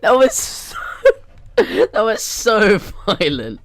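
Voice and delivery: high, loud, helpless laughter through the words; cracked up, words stretched by laughter; laughing hard. Real, not acted. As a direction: barely get it out for laughing.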